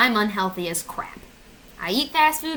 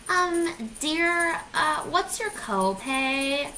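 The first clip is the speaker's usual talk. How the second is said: high voice, dragging out the question; hesitant receptionist; 5 semitones higher, much slower than usual. Acted. Receptionist asking a patient about copay, mock hesitant. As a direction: imitate a clueless clerk, slowing down.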